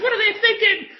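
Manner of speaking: high, laughing outcry; laughing shout. Real, not acted. Laughing exclamation.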